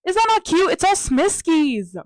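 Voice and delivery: high and loud, squealing over cute stickers; gushing excitement. Real, not acted. Showing her vlog viewers the sticker page, high excited gushing. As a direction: gush over it like a delighted fan.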